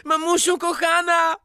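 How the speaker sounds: high, drawn-out cries for mummy; crying out. Acted. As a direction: wail it high, stretching the vowels. High drawn-out cries within story.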